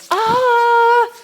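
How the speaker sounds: loud high ringing ah of delight; bright ah. Shown as a demonstration. Coach showing the class the sound, a loud delighted ah. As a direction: let out a big joyful ah. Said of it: "We should have that kind of sound".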